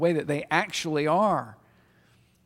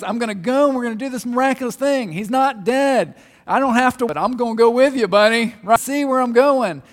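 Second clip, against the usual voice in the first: 1 animated Jesus; 5 semitones higher, 8 dB louder than usual. Retold or imitated. Preacher voicing Jesus reassuring Thomas.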